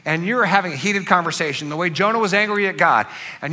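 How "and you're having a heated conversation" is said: usual speaking voice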